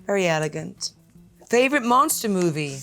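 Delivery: normal talking voice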